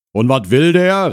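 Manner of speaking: called out. Acted. Husband calls from inside about the visitor, shouting. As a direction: holler the question from indoors. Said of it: "rief die Stimme".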